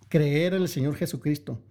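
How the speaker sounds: ordinary talk, nothing marked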